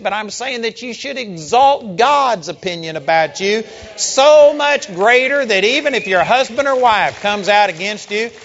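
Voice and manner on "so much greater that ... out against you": climbing higher and louder to the last word; building preacher climax. Real, not acted. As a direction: build until they're nobody rings out.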